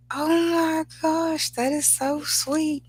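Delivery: high, slow, swooning mom voice; gushing mom. Retold or imitated. Mom touched by the surprise wedding, slow high gushing. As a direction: melt over it like a teary mom.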